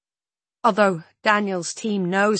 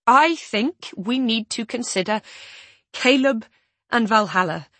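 higher and louder, desperate; desperate rising voice; 5 semitones higher, 8 dB louder than usual. A narrator's reading. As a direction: plead as the voice climbs. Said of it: "my voice rising with desperation".

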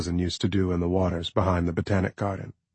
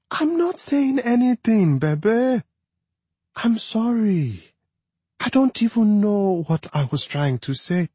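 apologetic; 13 semitones higher than usual. A narrator's reading. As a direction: soothe him with sorry. Wife apologizing to husband.

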